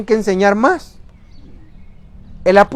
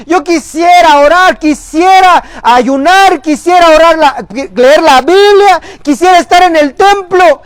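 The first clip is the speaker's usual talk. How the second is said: higher, louder tearful lament; mock tearful plea; 7 semitones higher, 6 dB louder than usual. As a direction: imitate a weeping believer who keeps failing. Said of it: "lloran y dicen". (lamento lloroso imitado, agudo y fuerte)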